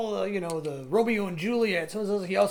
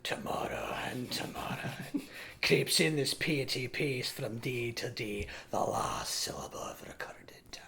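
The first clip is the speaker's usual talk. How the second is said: whispered, slow and much lower; hushed tragic Macbeth on a desk; somber dramatic recitation; 7 semitones lower, 11 dB quieter than usual. Retold or imitated. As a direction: mourn the lines in a hush. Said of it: "I stood up on the desk and I was like".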